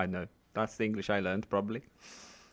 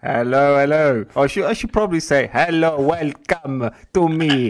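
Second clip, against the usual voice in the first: loud greeting; 4 semitones higher, 11 dB louder than usual. Real, not acted. Guest greeted loudly.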